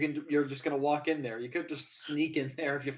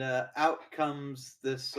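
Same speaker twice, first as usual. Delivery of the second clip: ordinary talk, nothing marked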